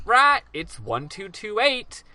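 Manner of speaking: slow, high parrot voice; squawk, then recite slowly up high; high-pitched bird reciting by rote. Retold or imitated. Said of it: "And he goes".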